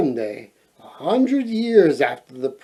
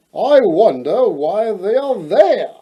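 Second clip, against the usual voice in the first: prince voice; 5 semitones higher, 5 dB louder than usual. Acted. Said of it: "he asked himself".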